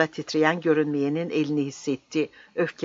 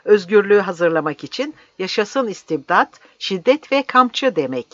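higher, louder defiant outburst; indignant raised voice; heated protest; 5 semitones higher, 5 dB louder than usual. Acted. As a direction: keep the protest ringing out, pitch climbing. Old man's shouted protest continues.

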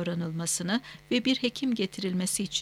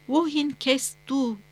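slow, higher-pitched questions in German; calling out; 5 semitones higher, 3 dB louder, slower than usual. A narrator's reading. Villager questions unresponsive stranger in German, calling out slowly. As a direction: call each question out slowly, word by word. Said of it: "diye seslendi".

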